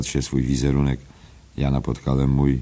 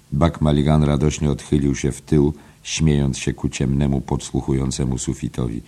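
normal talking voice

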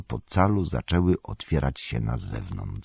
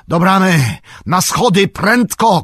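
much higher and louder, urgent; urgent shout; 13 semitones higher, 9 dB louder than usual. A narrator's reading. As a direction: yell the company to the gate. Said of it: "krzyknął Gandalf".

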